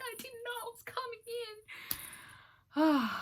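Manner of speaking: very high, slow, drawn-out whine; squeaky wail. Retold or imitated. Patient whining to nurses, very high and drawn out. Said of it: "And I was like".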